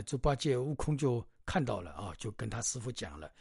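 usual speaking voice

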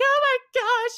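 high, loud mock gasp of a scared kid; high-pitched little kid "oh my gosh". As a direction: squeal it like a kid at the pool edge. Kid gasping at pool.

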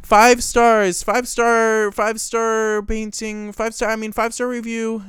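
loud, proud shout of the score; big announcement. Acted. After asking for a drum roll, host shouts the rating, loud reveal. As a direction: belt it out like a game show reveal.

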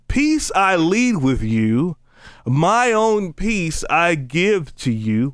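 very slow, drawn-out Bible reading; preacher reciting scripture with swelling emphasis. Acted. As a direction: linger on each phrase of the verse. Reads scripture aloud.

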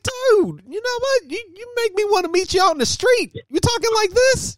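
high-pitched, loud mock outrage; mock confrontation. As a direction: squawk back at a trash talker, playing it up. Acted rant at trash-talking players.

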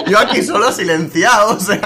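words through laughter; cracking up mid-sentence; laughing while talking. Real, not acted. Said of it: "llevo media hora despollándome".

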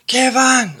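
high, loud call of "Kevin!" across a room; shouted name. Retold or imitated. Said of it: "somebody shouted".

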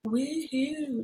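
a high, bright little cheer; happy woohoo. Real, not acted. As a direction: cheer at his text, delighted. Cheering boyfriend's text home.

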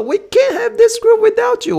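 very high, loud, drawn-out plea; pleading shout. Retold or imitated. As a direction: beg him to come, way up high. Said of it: "然后就说".

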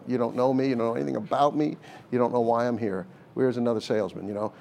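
ordinary delivery

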